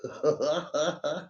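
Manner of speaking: short, soft chuckle; laugh. Real, not acted. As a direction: chuckle after your own mockery. Laughs after mocking the whiners.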